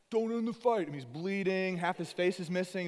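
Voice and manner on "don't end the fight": higher, louder pleading Rocky; battered Rocky. Retold or imitated. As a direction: beg from the corner like a beaten boxer. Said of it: "Rocky's like".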